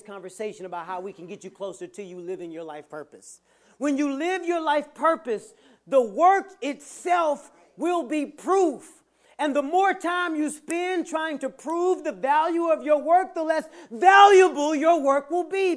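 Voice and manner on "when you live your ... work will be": slow, high, loud preaching cry; full-force preaching. Acted. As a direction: hammer each word home from the pulpit.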